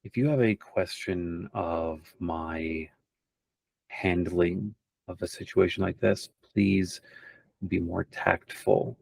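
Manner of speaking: quiet aside, in measured pauses; low confidential whisper. Acted. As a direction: speak softly, for one listener only. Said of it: "whispers specifically to Silver".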